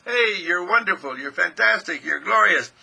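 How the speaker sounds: loud, emphatic praise; enthusiastic inner voice. Acted. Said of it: "your inner self talks to you and it says".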